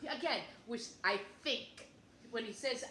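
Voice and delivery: normal talking voice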